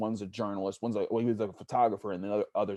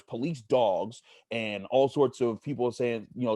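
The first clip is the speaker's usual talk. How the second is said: usual speaking voice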